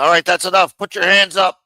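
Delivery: shouted cop command, high and loud; retold FBI order. FBI agent cornering the mobster, loud shouted order. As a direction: bark it like an agent cornering him. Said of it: "the guy, Romer, told him".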